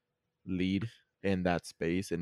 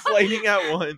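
laughing; 11 semitones higher, 6 dB louder, slower than usual. Real, not acted. Laughing at crosstalk.

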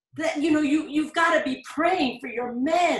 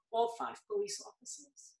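ordinary talk, nothing marked

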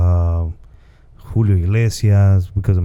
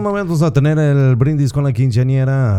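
Spanish party host announcing; MC announcer; 4 semitones higher than usual. Acted. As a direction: announce the toast to the crowd. Demoing quinceañera MC announcement.

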